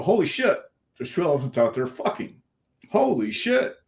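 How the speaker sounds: high, stunned disbelief; shocked at the sight. Retold or imitated. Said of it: "you're gonna come in and go".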